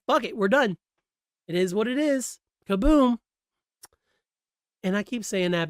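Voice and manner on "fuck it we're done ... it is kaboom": mock shrug-off. Imagining the creators quitting.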